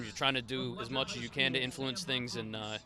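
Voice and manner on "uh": usual speaking voice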